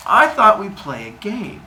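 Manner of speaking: acted sly suggestion; Zeus. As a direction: pitch the idea with drawn-out relish. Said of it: "And he goes".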